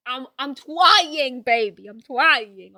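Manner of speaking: put-on loud, drawn-out "twying" with babyish W; hammed-up baby talk. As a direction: whine it like an exaggerated toddler. Hammed-up babyish repeat of trying.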